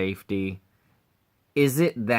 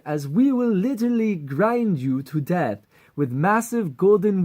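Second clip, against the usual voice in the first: put-on higher, louder vain Fabio accent; 6 semitones higher, 3 dB louder than usual. Vain general warning the heroes in a Fabio accent. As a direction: strut through the threat in a Fabio accent. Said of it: "Fabio appearance and accent".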